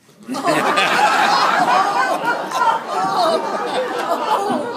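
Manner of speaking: loud high burst of laughter; cracking up at his own joke; big laugh. Real, not acted.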